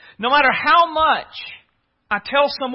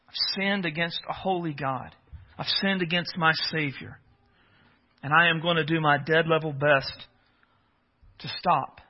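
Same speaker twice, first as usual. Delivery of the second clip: softer, low and earnest; sincere confession; 6 semitones lower, 8 dB quieter than usual. Retold or imitated. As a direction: confess it quietly, meaning every word.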